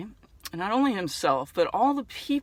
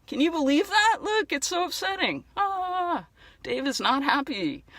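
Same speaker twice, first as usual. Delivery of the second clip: mock upset; 8 semitones higher than usual. Speaker laments for unhappy dinosaur Dave, high mock-upset voice. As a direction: pretend to be upset in a high, pleading voice.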